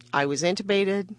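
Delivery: ordinary talk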